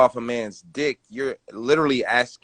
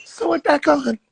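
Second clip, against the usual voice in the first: high, hoarse mock whisper; 13 semitones higher, 3 dB louder, slower than usual. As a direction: whisper it in a put-on voice. Mock quote in put-on voice.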